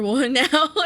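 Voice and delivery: ordinary talk, nothing marked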